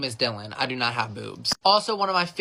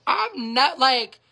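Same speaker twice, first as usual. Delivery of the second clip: ordinary delivery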